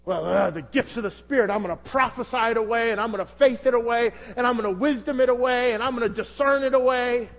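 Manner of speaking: mock the over-spiritual believer; mock spiritual bravado. Preacher mocking believers who pray problems away, in mock spiritual bravado. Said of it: "come at it with your spiritual".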